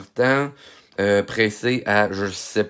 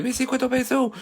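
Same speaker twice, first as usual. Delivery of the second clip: much higher mock viewer question; imitating a curious fan, pitched up; faster than usual. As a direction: play a curious viewer asking. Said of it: "plusieurs qui vont me demander".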